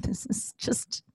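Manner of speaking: unvoiced, slow inner thought; whispered aside. Retelling her own exasperated thought, whispered slowly. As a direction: whisper it to yourself. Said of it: "thinking aloud to herself".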